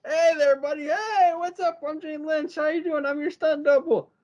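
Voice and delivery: high, bouncy guy-next-door greeting; goofy high stunt-double voice; cheery stranger. Retold or imitated. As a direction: greet him like an over-friendly buddy. New character greets at door.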